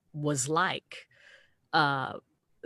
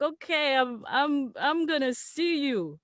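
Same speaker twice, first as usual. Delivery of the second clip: put-on high, slow, halting drunken promise; imitated drunk man; 7 semitones higher, slower than usual. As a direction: stumble tipsily through it, stretching words. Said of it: "He's so drunk".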